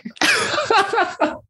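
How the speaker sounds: high giggle. Real, not acted. Laughing at own story.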